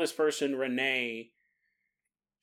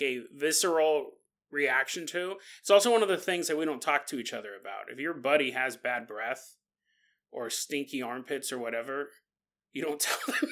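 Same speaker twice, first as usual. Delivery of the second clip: ordinary talk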